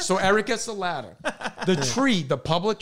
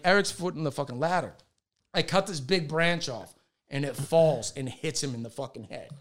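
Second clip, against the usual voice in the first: ordinary talk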